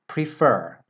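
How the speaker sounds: Prefer with the second syllable FER stressed. Shown as a demonstration. Teacher showing students the stress in Prefer. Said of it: "The stress comes on the second syllable".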